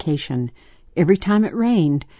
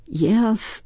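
yawning; 6 semitones higher than usual. A narrator's reading. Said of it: "answered with a yawn".

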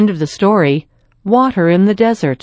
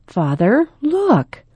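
in awe; 7 semitones higher, slower than usual. Acted. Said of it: "Lydia said in awe".

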